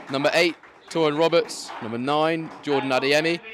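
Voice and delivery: proud fan announcer building up his own side; hyped home-fan announcer. Acted. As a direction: sell every Swans name to the crowd. Hypes his own team's lineup.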